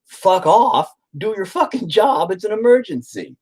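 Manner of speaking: high, insistent angry order; re-enacted angry yelling. Father yelling at hospital staff to work, angry high voice. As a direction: snap at them, pitched well up. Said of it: "I was yelling at 'em".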